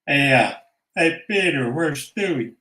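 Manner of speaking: ordinary delivery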